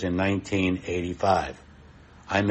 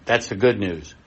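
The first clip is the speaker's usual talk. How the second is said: normal talking voice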